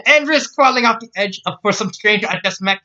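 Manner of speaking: high, rising Scots outburst; indignant shout. Acted. Scotswoman refusing to let Jack pass, indignant shouting. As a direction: build to a loud, high-pitched cry. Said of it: "she shouted at Jack".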